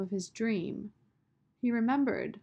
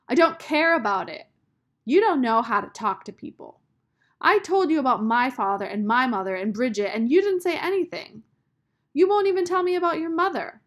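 loud, high rant, speeding at the end; angry shouting; 4 semitones higher, 10 dB louder than usual. A narrator's reading. Sistine rants at Rob for saying nothing, shouting. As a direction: keep yelling, faster and faster. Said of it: "she shouted".

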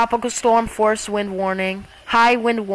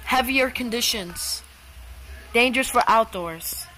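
weather alarm voice. Acted. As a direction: announce the storm like an automated alert. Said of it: "the voice just sounds like me".

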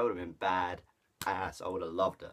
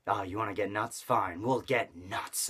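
understated Batman impression; lightly voiced Batman. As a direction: drag out "Fine, we'll get nuts" like a grim Batman. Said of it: "Batman says".

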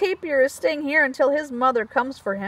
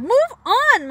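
high, loud holler; monkey hollers; 9 semitones higher, 5 dB louder than usual. Acted. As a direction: holler the bird away. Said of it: "Monkey hollers".

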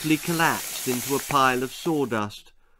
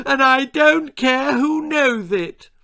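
bawling; 11 semitones higher, 5 dB louder than usual. A narrator's reading. Little Lizzie admitting she's scared, bawling. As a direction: howl it out tearfully. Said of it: "bawled Lizzie".

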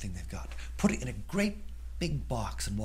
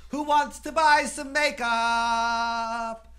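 very high, slow, loud hawker's call; girl offering her makeup for sale; 12 semitones higher, 8 dB louder, much slower than usual. Acted. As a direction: call out like a market seller. Julie sells her makeup.

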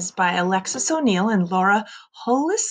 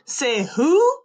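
growled; 5 semitones higher than usual. A narrator's reading. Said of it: "Mean Jean growled, howled".

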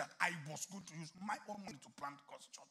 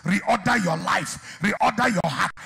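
loud, high prayer shout, slowing at the end; 6 semitones higher, 25 dB louder, slower than usual. Real, not acted. Preacher praying over the congregation, shouted high prayer. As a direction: cry out the prayer over them.

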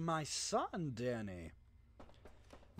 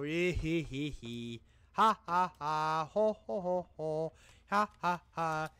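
faking madness; 3 dB louder than usual. Pretend. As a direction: cackle like a madman. Put-on maniacal laughter.

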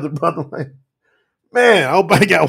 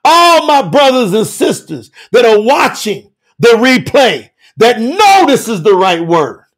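fervent prayer; 3 semitones higher, 5 dB louder than usual. Real, not acted. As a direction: call out to God in waves, phrase by phrase.

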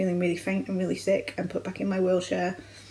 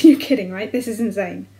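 louder, incredulous inner voice, building to exasperation; disbelieving outburst; 2 semitones higher, 7 dB louder than usual. Retold or imitated. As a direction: shout it as if it can't be real. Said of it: "my brain just goes".

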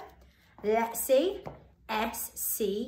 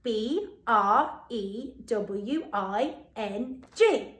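slow spelling; much slower than usual. Shown as a demonstration. Teacher spells the word for children, slowly. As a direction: spell chewing slowly, letter by letter. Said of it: "Let's have a little look".

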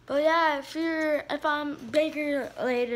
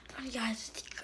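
whisper; 10 dB quieter, faster than usual. Real, not acted. Whispered question to viewers.

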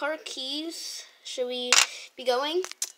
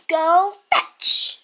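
calling fetch; 3 semitones higher, 8 dB louder than usual. Pretend. Throwing the ball for the dog.

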